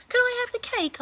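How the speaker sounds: put-on high, loud child's ask; eager boy. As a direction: beg for cake like a small boy. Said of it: "asked Lester".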